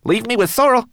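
very high, loud tiny voice; a squeaky homunculus; Twigleg. A narrator's reading. Twigleg answers Ben, high and squeaky.

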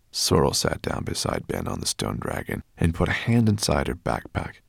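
ordinary delivery, nothing marked

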